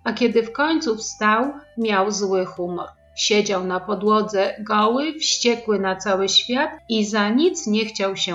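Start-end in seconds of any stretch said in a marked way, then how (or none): none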